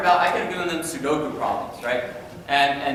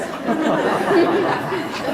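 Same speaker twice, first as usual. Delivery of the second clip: laugh; 12 semitones higher than usual. Real, not acted. Teacher laughs at own joke.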